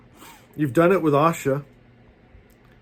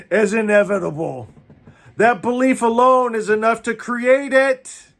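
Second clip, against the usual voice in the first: rally the congregation to stand up; fired-up preacher calling out to the Magi; preaching at full force; 5 semitones higher than usual. Real, not acted.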